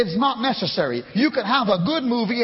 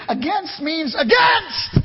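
slow, loud, high "against" both times; emphatic shout; 7 semitones higher, 4 dB louder, much slower than usual. Real, not acted. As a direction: hammer "against means against". Preacher hammering home one word.

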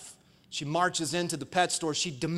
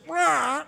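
high drawn-out bird squawk; sudden piercing parrot cry; 9 semitones higher than usual. Acted. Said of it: "the parrot goes".

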